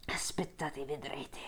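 soft low growl. A narrator's reading. Odisseo threatening the suitors, soft low growl. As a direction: growl the threat under your breath. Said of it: "ringhiò Odisseo".

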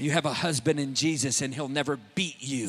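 tender comfort. Retold or imitated. Preacher retelling soothing words to the abused woman. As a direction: speak gently to a hurting woman.

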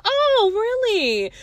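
high, slow mock-surprised "oh really"; sarcastic disbelief. Sarcastic retort in retold phone argument.

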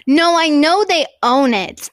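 ordinary talk, nothing marked

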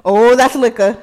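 loud mock-shocked exclamation; mock dad's discovery. Playing the dad tasting his child's drink, loud mock alarm. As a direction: blurt it out as a dad who just tasted alcohol. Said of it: "He was like".

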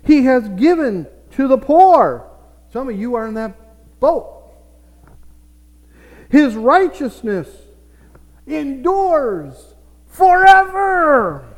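loud, high, drawn-out scripture proclamation; full-force pulpit delivery; preaching proclamation. Real, not acted. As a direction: proclaim the verse with long, ringing words.

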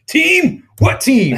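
high incredulous yelp. Real, not acted. Mock outrage at Denzel pick.

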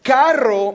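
Carro said slowly with a long trilled rr. Shown as a demonstration. Pastor showing the church the long rr. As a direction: roll the rr in Carro. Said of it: "That's different".

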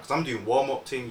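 ordinary delivery

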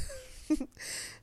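breathy laugh. Real, not acted. Laughs at herself.

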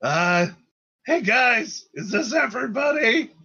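high-pitched shout to a crowd; little leader calling to the group. Acted. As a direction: holler up high to gather everyone. Spit calls out to the crowd.

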